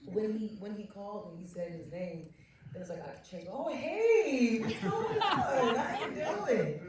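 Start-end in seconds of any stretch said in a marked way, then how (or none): 3.5-6.8 high, loud, drawn-out hello; gushing mock greeting. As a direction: playfully gush in an exaggerated high voice. Acts out a changed, gushing greeting.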